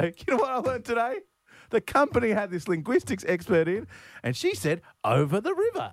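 high, put-on voice of an eager parent; mock impressed parent. Parent telling the kids about work, mock excitement. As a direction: share trivial news as a marvel. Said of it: "they go to their kids".

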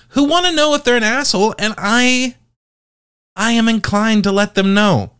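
high, sing-song and teasing; high playful voice. Acted. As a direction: go way up, playful and sly.

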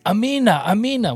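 high, ringing call of amina; sung-out church response; crowd calling amen. Retold or imitated. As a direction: call it out like a joyful congregation. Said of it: "recognize that phrase says".

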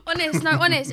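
laughing. Real, not acted. Insisting through laughter.

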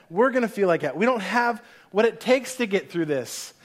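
ordinary talk, nothing marked

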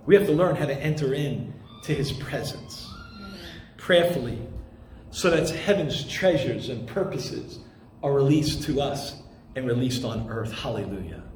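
low, soft reverent hush; hushed prayerful tone. Acted. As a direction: pray it down low and softly. Preacher drops into soft prayer.

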